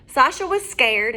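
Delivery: plain reading voice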